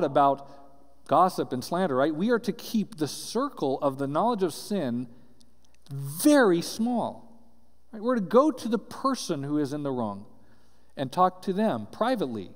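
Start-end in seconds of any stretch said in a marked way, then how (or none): 5.9-7.4 slow emphasis. Acted. Preaching point, slowed down.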